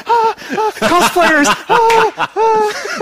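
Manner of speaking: high, giddy squeals at the cosplayers; excited squeal. Retold or imitated. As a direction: gasp it out like a starstruck kid. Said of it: "we'd be like".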